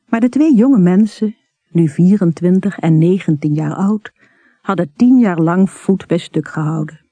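plain reading voice

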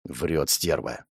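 soft, slow muttered aside; quiet aside. Acted. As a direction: say it under your breath. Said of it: "тихо сказал Жуков".

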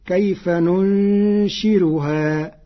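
ننشرها chanted slowly with a thin, light ra; ننشرها بترقيق الراء; light r in Warsh reading. Shown as a demonstration. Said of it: "بالراء بدلا من الزاي، ورقق الراء".

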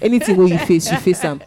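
usual speaking voice

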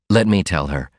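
ordinary delivery

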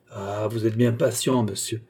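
usual speaking voice

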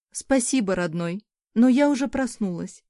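ordinary delivery

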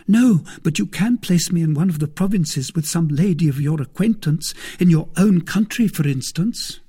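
high, insistent maid's voice; pleading maid. Acted. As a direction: press the request, pitched high and loud.

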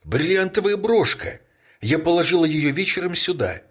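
high, loud alarmed cry; sudden exclamation. A narrator's reading. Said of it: "Внезапно она воскликнула". (горничная вскрикивает, заметив пропажу: взволнованно)

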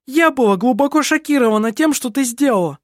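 high, heated protest; shouted self-defence. A narrator's reading. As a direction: cry out in indignant denial. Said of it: "крикнула Лисин".

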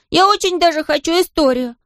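put-on high, eager child begging; high-pitched childlike pleading; excited cry. A narrator's reading. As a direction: plead excitedly like a small boy. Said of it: "закричал он".

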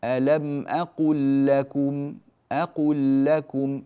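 أقل لكم as aqullakum, doubled lam held; slow recitation with one doubled lam; merged lam. Shown as a demonstration. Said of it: "فندغم اللام في اللام كذلك".

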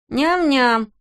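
put-on high child's shout; a toddler yelling out. A narrator's reading. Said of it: "выкрикнул мальчик".

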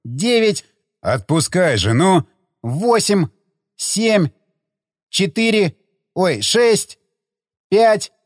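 manic countdown. Acted. Zagorsky counts down madly.

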